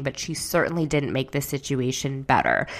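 ordinary delivery

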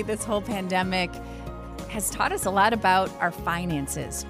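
usual speaking voice

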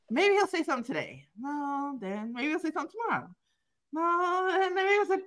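hope against hope under her breath; hopeful inner musing. Retold or imitated. [name] recalling daily hopes he'd speak, quick and soft.

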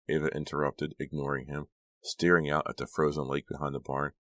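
ordinary delivery

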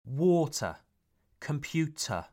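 Water and Computer ending in 'uh', no R; non-rhotic. Shown as a demonstration. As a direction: drop the last R in Water and Computer. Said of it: "You don't hear the R".

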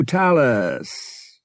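loud, high, sing-out farewell; hearty goodbye. A narrator's reading. Dale half out the door to Han, hearty goodbye. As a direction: call it out high on the way out.

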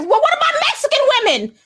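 a hammed-up whataboutism voice, fast and high; mocking whiny heckler. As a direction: lampoon the person derailing the talk. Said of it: "here comes Mex".

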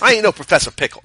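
loud, high indignant yell; indignant shout. Acted. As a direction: shout it, offended. Mock indignant character voice.

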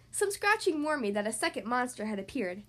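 ordinary delivery, nothing marked

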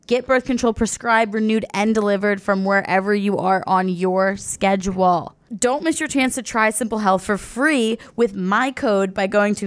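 upbeat sponsor read. Acted. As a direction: read it like a podcast ad. Host reads sponsor ad.

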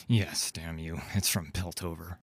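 low, resentful man's voice; low, sullen pirate admission; grudging corsair. A narrator's reading. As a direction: give in grudgingly, low and dropping off.

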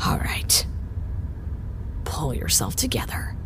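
soft whisper, slowing down; whispering to herself. Acted. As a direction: whisper to steady yourself. Alone, steadying herself after a call.

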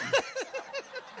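high, bright burst of laughter. Real, not acted. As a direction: crack up at yourself. Laughs at going along.

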